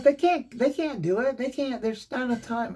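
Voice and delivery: high, pleading wife voice, a bit mocking; husband mimicking his wife's panic, pitched high; imitating wife. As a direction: play the worried wife insisting it's over. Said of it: "my wife".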